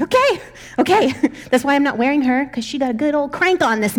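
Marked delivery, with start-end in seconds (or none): 0.0-1.1 laughing okay. Retold or imitated. Placating the angry baby.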